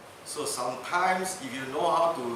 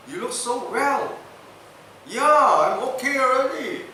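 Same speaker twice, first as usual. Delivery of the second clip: cheerful reply; 9 semitones higher, 7 dB louder than usual. Retold or imitated. Friend and governor talk.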